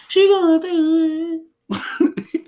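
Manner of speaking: very high, long-drawn hammed-up lament; big mock wail. Repeats mock wail, bigger.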